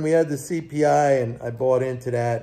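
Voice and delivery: ordinary talk, nothing marked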